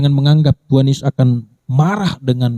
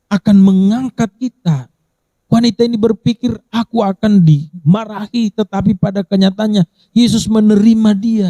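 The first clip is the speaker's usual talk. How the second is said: higher, rising pulpit fervour; fervent preaching; 7 semitones higher than usual. Acted. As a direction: preach it out to the whole congregation. Preacher building to full force.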